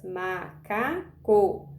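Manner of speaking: "Macaco" in three slow beats, ma - ca - co; separação em sílabas. Shown as a demonstration. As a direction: separate each syllable of "Macaco". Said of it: "estou falando de três sílabas". ("Macaco" bem devagar, sílaba por sílaba)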